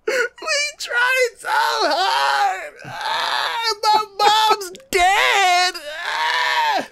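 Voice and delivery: very high, drawn-out hammed-up wail; fake-cry it slowly, howling every word; over-the-top anime sobbing shriek; mock bawling. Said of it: "the whole team just starts crying".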